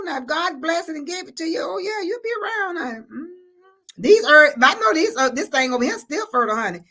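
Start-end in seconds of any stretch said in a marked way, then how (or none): none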